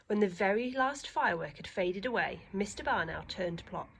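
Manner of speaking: plain reading voice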